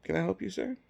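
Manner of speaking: high-pitched imitation of a receptionist; secretary voice. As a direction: put on a high, polite secretary voice. Voicing secretary greeting him.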